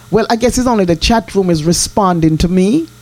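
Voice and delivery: ordinary talk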